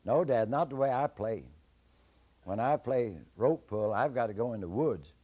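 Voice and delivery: put-on perky boy answering the giant; Jack's voice. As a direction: Jack raising his voice a little.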